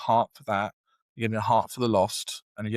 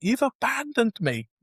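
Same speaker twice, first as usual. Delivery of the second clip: put-on high, raised retelling of a teen's rage at God; angry at God; 6 semitones higher than usual. Teen abandoned by God rants at him, high and hurt. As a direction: hurl the complaint upward, wounded. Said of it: "I'm getting so angry".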